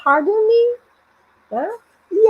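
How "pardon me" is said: "Pardon me" high and louder, questioning; lifted, loud "Pardon me"; asking to repeat. Shown as a demonstration. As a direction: ask "Pardon me" to hear it again. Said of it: "pedindo pra pessoa repetir".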